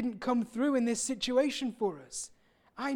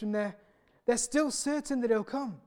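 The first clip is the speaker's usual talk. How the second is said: ordinary talk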